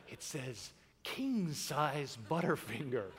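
low, slow, soft announcer read; imitating a kid's radio voice. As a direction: savour each word like an announcer. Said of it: "voice turned into a radio announcer".

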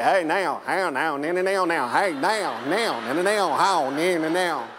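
lightly done Arkansas backwoods drawl in twangy bursts; Arkansas backwoods accent. Retold or imitated. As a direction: Arkansas guy greeting the pastor in his backwoods accent. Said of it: "he spoke banjo".

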